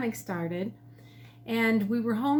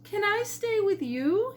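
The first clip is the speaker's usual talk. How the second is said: higher, hopeful little bird voice; hopeful pheasant; 10 semitones higher than usual. A narrator's reading. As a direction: ask like a lonely bird. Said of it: "he asked".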